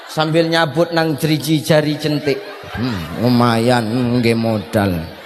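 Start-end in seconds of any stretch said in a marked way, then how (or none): 2.7-5.1 greedy aside. Acted. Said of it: "husband mutters greedily aside".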